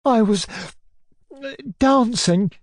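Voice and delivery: high, halting, tearful answer; mastering his sobs. A narrator's reading. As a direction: fight down sobs to get it out. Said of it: "mastering his sobs".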